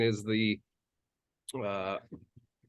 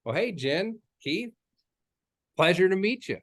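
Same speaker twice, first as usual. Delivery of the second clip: slowed down greeting; 6 semitones higher than usual. Shown as a demonstration. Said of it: "slow it down".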